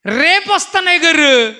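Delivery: high, slow, taunting put-on voice; high, drawn-out jeering character voice; mocking carefree voice. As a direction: sneer it like a man who thinks he has time. Scornful mock of a careless sinner.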